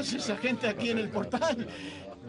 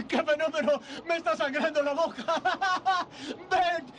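laughing, fast and higher with giddy joy; ecstatic; 4 semitones higher than usual. Acted. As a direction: bubble over with delight. George ecstatic about bleeding mouth.

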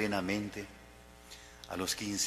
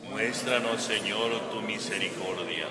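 congregation response; 3 dB louder than usual. Real, not acted. Assembly answers the prayer together.

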